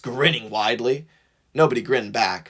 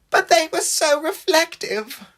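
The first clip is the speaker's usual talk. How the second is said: high, slow, pitiful whine; drawn-out, high, wistful misery; miserable longing. A narrator's reading. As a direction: whine it high with a quivering pout. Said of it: "James's lip quivered miserably".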